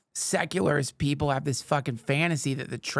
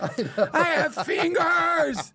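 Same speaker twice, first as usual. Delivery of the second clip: very slow, high shouted wail; comic shriek of discovering your own hands; mock amazement; 13 semitones higher, 3 dB louder, much slower than usual. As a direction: stretch it out in hammed-up astonishment, near laughter. Acting out bewildered people, hammed up.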